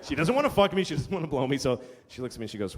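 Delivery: ordinary talk, nothing marked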